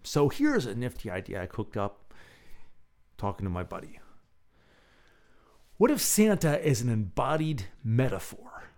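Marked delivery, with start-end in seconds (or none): none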